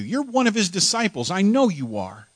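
high, insistent accuser; accusing soldier. Acted. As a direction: pin him down with it.

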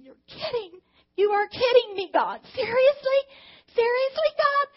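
rising, loud, incredulous cry to God; half laughing, half crying protest; shocked disbelief. Retold or imitated. Woman stunned when God asks this, crying out "seriously?".

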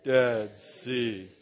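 low, lifeless monotone; Dead Sea in a low dead voice; emotionless. Shown as a demonstration. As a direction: say Dead Sea with no feeling at all. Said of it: "as little emotion as possible".

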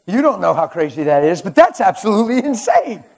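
high, loud laughing incredulity; a preacher laughing in disbelief at his wife. Real, not acted.

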